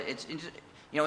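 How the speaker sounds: usual speaking voice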